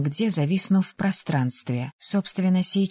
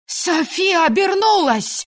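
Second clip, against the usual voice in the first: much higher, slow alarmed yell; street-wide cry; 12 semitones higher, 3 dB louder, slower than usual. A narrator's reading. A neighbour warns the street the wolf is out, yelling. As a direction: call the alarm down the whole street. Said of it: "раздался крик на всю улицу".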